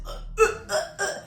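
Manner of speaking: high, strained choking grunts; imitated choking. Girl grabbed by the neck, choking. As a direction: gag in short mock gasps.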